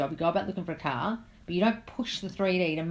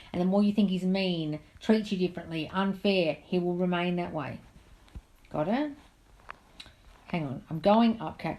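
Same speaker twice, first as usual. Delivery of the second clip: usual speaking voice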